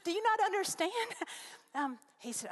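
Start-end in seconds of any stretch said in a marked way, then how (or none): 0.0-0.9 much higher and louder, astonished; high, loud retold incredulity; incredulous disbelief. Her thought, incredulous at pastor. 1.0-1.7 laugh. Real, not acted.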